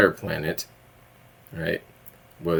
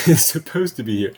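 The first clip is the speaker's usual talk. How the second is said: starts with a laugh, a bit louder; amused repeat of It's supposed to be here; chuckling at the mismatch; 4 semitones higher, 4 dB louder than usual. Real, not acted.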